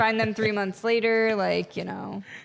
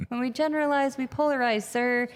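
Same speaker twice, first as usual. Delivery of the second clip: higher-pitched reenactment of addressing a superior; retold speaking up; 6 semitones higher than usual. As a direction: pipe up to the general in a higher voice.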